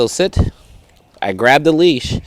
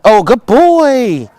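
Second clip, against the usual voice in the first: slow, high drawn-out praise; praising the dog; 8 semitones higher, 4 dB louder, much slower than usual. Real, not acted. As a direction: stretch it long and happy.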